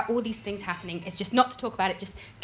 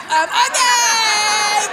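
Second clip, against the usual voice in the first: shocked shout; 7 semitones higher, 13 dB louder, much slower than usual. Acted. Mock-shocked reaction to heckle.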